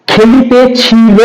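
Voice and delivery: খেলিতে ছিল said slowly with each syllable drawn out; the formal form খেলিতে ছিল pronounced slowly and fully; সাধু রীতির খেলিতে ছিল, ধীরে ধীরে; old bookish form. Shown as a demonstration. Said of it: "এটার ক্ষেত্রে বাংলা উচ্চারণ কেমন হয়?".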